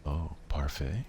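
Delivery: ordinary talk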